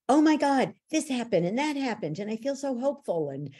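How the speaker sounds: imitating a thrilled, hopeful client; retold breathless-with-news excitement; excited caller. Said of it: "she calls me and she goes".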